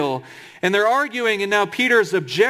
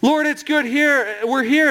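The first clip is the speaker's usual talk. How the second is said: overeager mock of Peter barging in; mock blurting Peter; 6 semitones higher than usual. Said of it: "Come on, man, read a room".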